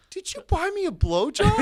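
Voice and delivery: very high, loud imitated friend; squeaky-high retold excitement; delighted disbelief. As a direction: ask in giddy mock amazement. Voices waking friend's delighted question.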